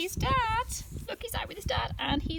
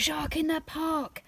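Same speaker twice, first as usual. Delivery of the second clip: a higher, playful cry of alarm; mock shout; 6 semitones higher than usual. Tim spots a shark, playfully shouted. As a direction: call it out like a warning. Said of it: "He shouts".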